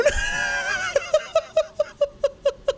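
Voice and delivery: a real, shrieking laugh of delight. Real, not acted. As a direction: let out a thrilled laughing shriek. Excited laughing shout.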